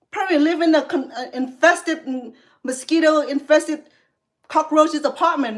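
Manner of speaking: angry rant. Real, not acted. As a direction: lash out at the troll in rising anger.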